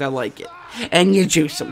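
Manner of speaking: normal talking voice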